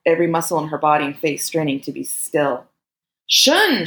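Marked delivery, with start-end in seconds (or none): 3.3-3.9 high, loud cry of alarm; panicked high shout; fearful scream. A narrator's reading. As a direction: scream the name in fear. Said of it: "she screamed, her voice ragged with fear".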